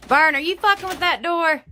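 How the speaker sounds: real angry scared shout. Real, not acted. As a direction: yell across the house at Vern. Yelling at father-in-law.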